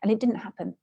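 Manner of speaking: usual speaking voice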